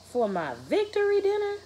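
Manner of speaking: high, loud, incredulous question; can't believe this is dinner; indignant disbelief. Acted. Josh to Mom, outraged that hummus is his victory dinner.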